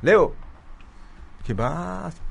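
bright surprised hello; happy greeting. Retold or imitated. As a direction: greet an old friend warmly. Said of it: "¿Y qué le dijiste?".

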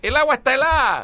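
put-on high, loud whining kid; in ice cold water. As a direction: complain the water is freezing. Kid complaining at cold lake.

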